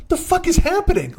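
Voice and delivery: high-pitched mock bafflement; baffled. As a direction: imitate a friend totally bewildered. Baffled friend's reaction acted out.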